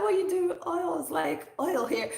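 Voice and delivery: high-pitched imitation of dad's Nigerian accent. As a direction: put on an exasperated Nigerian father. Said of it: "This is how he said it".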